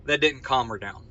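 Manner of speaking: ordinary talk, nothing marked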